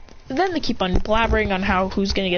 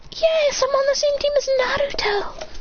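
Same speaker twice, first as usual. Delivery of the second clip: put-on very high-pitched girlish voice; tiny high cartoon-girl voice; Hinata. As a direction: put on a squeaky kid voice. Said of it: "I do a perfect Hinata impression".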